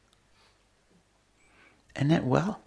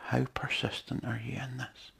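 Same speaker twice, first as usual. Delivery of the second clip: whispered; 7 dB quieter than usual. Retold or imitated. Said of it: "he's just waiting for you and say".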